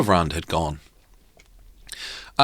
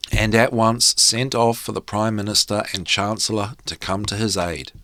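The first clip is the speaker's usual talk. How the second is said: plain reading voice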